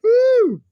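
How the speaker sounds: high, very loud woo; loud whoop. Real, not acted. Laughing at Moxley's clip, a loud high whoop. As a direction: let out a big whoop. Celebrating the funny moment.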